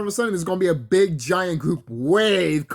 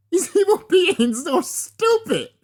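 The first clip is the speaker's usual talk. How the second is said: laughing mock; 5 dB louder, slower than usual. Mocking evil beings in meditation.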